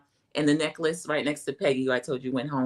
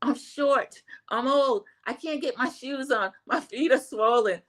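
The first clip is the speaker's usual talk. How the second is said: quoting Amy; 8 semitones higher, slower than usual. Retold or imitated. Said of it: "She says".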